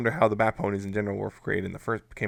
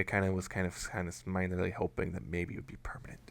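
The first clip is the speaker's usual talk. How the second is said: whispering; 3 semitones lower, 11 dB quieter than usual. Real, not acted. Confessing a secret hope.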